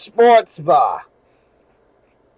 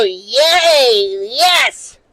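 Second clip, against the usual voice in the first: excited cheer; 4 semitones higher than usual. Retold or imitated. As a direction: blurt out a happy cheer for the team. Cheering at the Yankees score.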